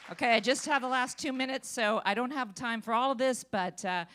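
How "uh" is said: ordinary delivery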